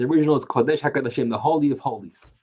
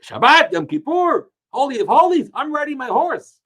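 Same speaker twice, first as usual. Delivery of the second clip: proud defiance, pitched up high; defiant statement; 11 semitones higher than usual. Retold or imitated. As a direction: fling it out as a provocation. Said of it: "He was trying to say".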